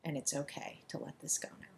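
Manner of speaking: gentle releasing whisper; soft whisper. Shown as a demonstration. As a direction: hush it as a release. Guided tapping affirmation.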